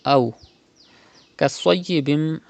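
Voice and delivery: أو with short unstretched waw leen, no break; waw leen demo. Shown as a demonstration. Teacher models waw leen for students, smooth and short. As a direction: say أو كصيب smoothly, waw not stretched. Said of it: "like this".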